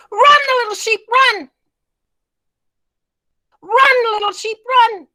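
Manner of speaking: high, loud urgent call. Shown as a demonstration. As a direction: shout a frantic warning. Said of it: "when I do this, you just repeat it".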